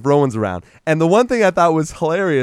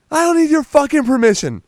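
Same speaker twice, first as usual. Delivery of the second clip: angry retort; 13 semitones higher, 4 dB louder than usual. Retold or imitated. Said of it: "And he's like".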